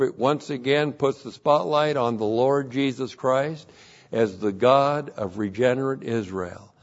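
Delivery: normal talking voice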